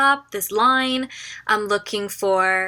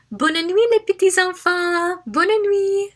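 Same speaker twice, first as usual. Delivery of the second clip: put-on high, louder sing-song voice for small children; talking to little kids; 10 semitones higher, 5 dB louder, slower than usual. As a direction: coo goodnight to little ones. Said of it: "to the little kids, you're like".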